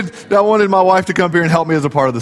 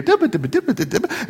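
quick singsong nonsense chatter; toddler babble; 6 dB quieter, much faster than usual. Retold or imitated. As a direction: babble like a chatty little girl. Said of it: "they would wanna talk with you".

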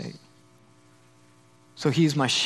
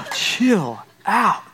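exasperated owner. Acted. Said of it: "And you're like".

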